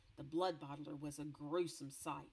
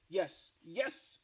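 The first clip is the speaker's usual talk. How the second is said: louder gloating insistence; booming giant; 6 dB louder than usual. Acted. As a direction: boom it back. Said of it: "boomed the blood bottler".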